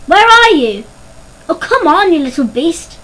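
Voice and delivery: high, loud calling out that eases off; impatient call. A narrator's reading. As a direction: call crossly to someone out of sight. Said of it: "cried Melody once more".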